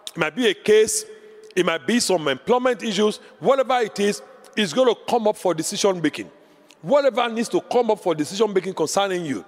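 ordinary delivery

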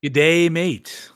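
slow, drawn-out Australian greeting; mock Australian accent. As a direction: put on a playful Australian accent. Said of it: "it's all Australian".

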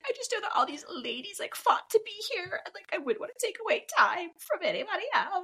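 high-pitched imitation of a weepy contestant; lightly put-on tearful pleading, no real sobs; mock crying. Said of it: "she's crying and she's like".

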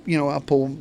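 normal talking voice

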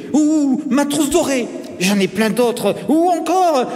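put-on high-pitched child, pleading with teacher; eager boastful boy. A narrator's reading. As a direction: bargain eagerly in a schoolboy voice.

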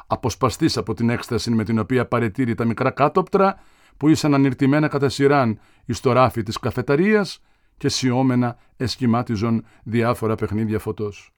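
ordinary delivery, nothing marked

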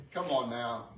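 usual speaking voice

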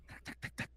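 soft voiceless clacking; imitated padlock rattle. Padlock knocking on the closet door, imitated softly. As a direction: tick out a quiet, quick rattle. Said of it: "Y de repente se escucha".

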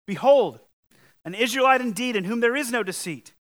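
Jesus calling out in admiration, loud proclamation; proclaiming Jesus. Retold or imitated. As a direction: announce it with wonder, loud and high. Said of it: "and said of him".

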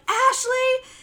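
high, loud mock reproach; scolding mom. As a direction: imitate a mom calling out the culprit. Mom scolds over smashed bird.

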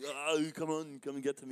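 half-hearted repeat of an Arnie holler; Arnie impression. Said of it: "covers himself in mud and goes".

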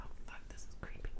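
spooked whisper; whispered creeped-out aside. Retold or imitated. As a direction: breathe it out scared of the dark. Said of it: "I was like".

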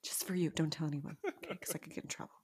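hushed, low, secretive aside; conspiratorial teacher. Acted. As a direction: imitate a teacher granting a secret favour. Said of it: "I think the teacher would be like".

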